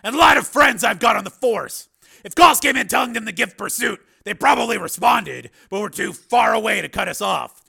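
high, loud, forceful explanation; vigorous, high-pitched briefing; Steele, emphatic. Acted. Steele explains lack of pursuit.

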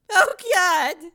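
loud anguished wail. Acted. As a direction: cry it out in despair. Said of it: "She wailed miserably".

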